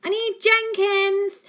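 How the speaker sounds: loud, slow, booming call; big booming yell. A narrator's reading. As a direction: bellow the name across the house. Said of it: "yelled in his biggest, boomiest voice".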